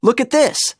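high, eager little-fish call; excited cry. A narrator's reading. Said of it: "he cried".

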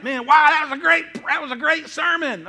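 high, excited churchgoer impression; mock gushing. Preacher mimics churchgoers praising the sermon, mock-thrilled.

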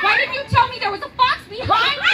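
loud high laughing alarm; mock startled. Teacher pretends to be startled by the fox, laughing loudly. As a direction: play up the surprise for the kids.

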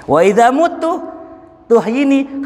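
high, loud final demand; climactic cry. Acted. Boy setting his final condition to the scholar, loud climax. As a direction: hit the last condition hard.

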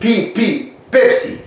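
"بيبسي" with a puffed p, "pepsi"; correct P sound. Shown as a demonstration. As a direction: drill the p of "بي" and "بيبسي". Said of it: "لا".

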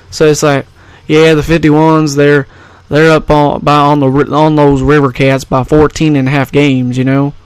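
casual Southern twang; Southern accent. Shown as a demonstration. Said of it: "I can do a Southern accent".

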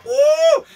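sudden high whoop; squealed woo. Real, not acted. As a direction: let out a high whoop of disbelief. Exclaiming over radiator nightmare.

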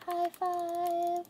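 high-pitched playful voice; squeaky high five. Pretend. High five with game character.

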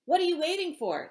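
loud, high yell. A narrator's reading. Star yells at the driver to go, loud and high. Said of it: "the stranger yelled at Noah".

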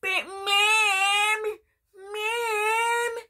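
put-on high, loud whining kid voice; whiny Cartman. Wussy Cartman whining to his mom, high and teary. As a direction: whine like a crying little boy. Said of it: "how much of a wussy Cartman is".

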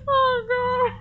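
high, whimpering fear; terrified. Real, not acted. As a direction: near tears with fright. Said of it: "I am so scared".

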